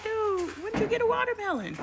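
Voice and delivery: high, mock disbelieving question; incredulous retort. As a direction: squeak out your disbelief. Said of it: "I'm like".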